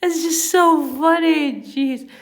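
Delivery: high, loud pleading through laughter; helpless real laughing. Real, not acted. Reader begging the character Ford to stop, laughing. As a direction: beg for mercy while cracking up. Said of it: "I can't stop laughing".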